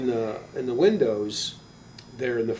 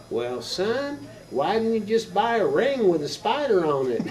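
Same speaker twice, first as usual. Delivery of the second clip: put-on Texas mom accent; 8 semitones higher than usual. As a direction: imitate a puzzled mom from Texas. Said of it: "She was from Texas and never lost her accent".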